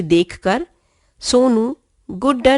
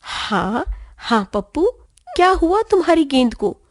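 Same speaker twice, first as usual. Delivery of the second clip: lovingly; 6 semitones higher than usual. A narrator's reading. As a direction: comfort her little son gently. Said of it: "प्यार से".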